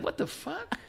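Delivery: high, incredulous imitation; mock disbelief. Voicing agent's look at the food order, incredulous. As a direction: voice a baffled look in a high pitch. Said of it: "that's the look he had".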